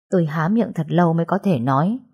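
usual speaking voice

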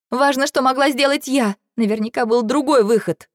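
high, agitated insistence; insistent self-blame. A narrator's reading. Girl blaming herself to her friend, insistent. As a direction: push the point with rising distress. Girl argues back, guilt-stricken.

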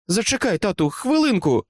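high-pitched mock cry, no real volume; understated shout in a high girlish voice; calling out. A narrator's reading. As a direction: call out to stall, pitched well up. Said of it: "крикнула Джулі".

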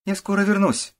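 high, joyful calling out; cheerful call. A narrator's reading. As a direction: call out as happily as you can on leaving. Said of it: "крикнула как можно радостнее". (радостный возглас на прощание)

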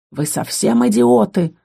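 slow, growling rebuke; growled insult. A narrator's reading. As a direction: growl it out at the fools. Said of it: "рыкнул боевик".

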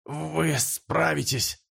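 slow words through a mouthful of blood; choking on blood. Acted. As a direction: force it out slowly, dying. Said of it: "захлёбываясь кровью".

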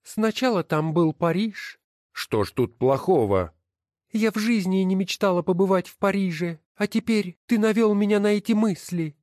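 high, scornful woman's voice; wife's voice. Acted. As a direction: sneer at each title as you sort them. Wife complains to her husband. (высокий насмешливый голос жены)

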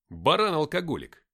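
loud, irritated insult; snapping back. A narrator's reading. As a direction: throw the jibe straight back. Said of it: "огрызнулся я". (огрызаясь, громко)

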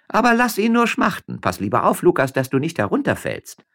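high, lively miller's wife; Frasquita voice. A narrator's reading. Frasquita teasing her husband up in the vine, high. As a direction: pitch up, playful and teasing.

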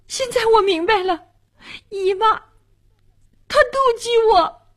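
put-on high-pitched, agitated girl; upset accusation. A narrator's reading. Girl accuses her aunt of jealousy, upset and high. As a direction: build into a high, wounded accusation.

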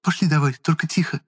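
high, restrained urging; woman's voice. A narrator's reading. Woman leading boy out secretly, urging. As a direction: urge along in a high voice.

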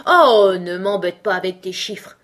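annoyed exclamation; irritated cry. A narrator's reading. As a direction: cut the child off, fed up. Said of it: "s'écria la duchesse".

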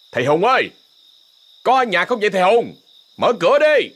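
shouting toward the house. Acted. Officer calling at the door.

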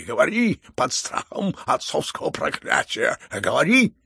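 loud, slow curse between rising roars; frenzied roar. A narrator's reading. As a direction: roar like a father threatening his curse. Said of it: "ревел генерал в совершенном исступлении".

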